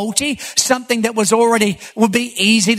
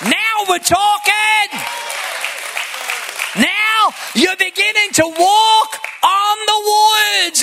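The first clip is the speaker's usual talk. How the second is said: rising preacher's voice with shouted peaks; fiery preaching; 6 semitones higher than usual. Acted. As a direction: drive it home to the congregation. Preaching climax.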